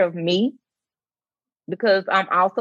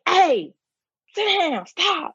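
high-pitched mock scolding; raised voice; 11 semitones higher, slower than usual. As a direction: act out snapping at kids, pitch leaping up. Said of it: "to raise my voice".

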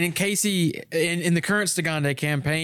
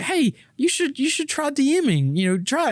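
eager coaxing; 11 semitones higher than usual. Retold or imitated. Retelling coaxing friend to run games.

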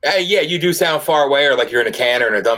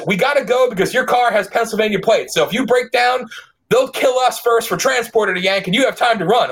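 higher, lightly voiced Kentucky local explaining; deadpan warning, voiced higher; emulating a Kentucky buddy; 8 semitones higher than usual. The Ashland buddy explaining the danger to the host, lightly voiced. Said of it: "I'll emulate him".

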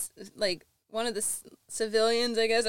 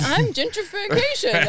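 mock voice; 11 semitones higher, 6 dB louder, slower than usual. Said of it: "That's why you're saying".

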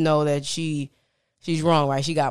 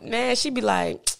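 normal talking voice

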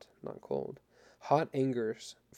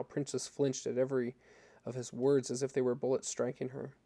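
ordinary delivery, nothing marked

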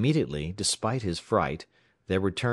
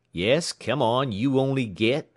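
thundering demand; 6 semitones higher, 4 dB louder than usual. A narrator's reading. Tramp mocking the stammering clerk, loud. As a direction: boom it at him impatiently. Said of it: "thundered the tramp".

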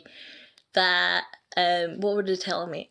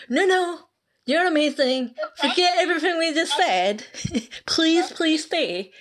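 mock pleading; 7 semitones higher than usual. Mocking how the clan should beg a quitter, pleading. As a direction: ham up groveling to someone leaving. Mock begging a leaving member.

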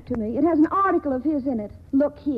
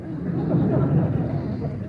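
breathy laugh. Acted. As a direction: laugh under your breath at the dull title. Laughs at the article.

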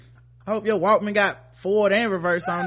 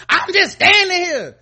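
high loud mock of her plea; high loud mocking imitation; mock innocent; 12 semitones higher, 9 dB louder than usual. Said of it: "she was like".

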